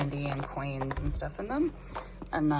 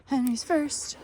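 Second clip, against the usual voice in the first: high sing-song; 10 semitones higher, 5 dB louder, slower than usual. Acted. Letting the hens out.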